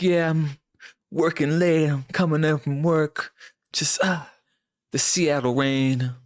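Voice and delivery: hushed, breathy mock-seductive heartthrob, slowing to a drawl; mock brooding heartthrob.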